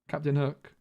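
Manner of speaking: ordinary talk, nothing marked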